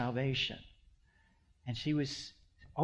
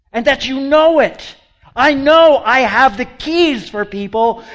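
full-force preaching; 10 semitones higher, 16 dB louder than usual. Acted. Preacher proclaiming Jesus's promise to the congregation, booming. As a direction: thunder the promise at the church.

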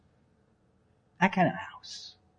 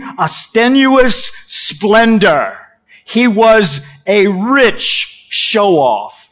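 grand scholar declaiming; slow, emphatic academic pronouncement; scholarly voice; 2 semitones higher, slower than usual. Acted. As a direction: stretch out the key words with relish. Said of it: "Scholarly voice here".

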